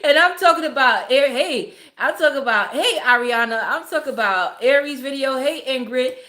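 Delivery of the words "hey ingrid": ordinary talk, nothing marked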